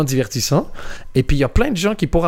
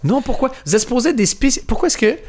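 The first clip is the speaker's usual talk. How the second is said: exasperated high protest; 9 semitones higher, slower than usual. Retold or imitated. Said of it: "Tu fais".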